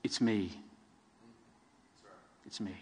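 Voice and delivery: soft, low, fading "It's me"; whisper-close comfort, almost gone; reassurance. Acted. Jesus comforting his scared disciples, soft and fading. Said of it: "two wonderful words of reassurance".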